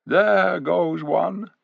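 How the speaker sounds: a hint of a wise eagle; eagle's inner voice. A narrator's reading. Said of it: "thought Farsight".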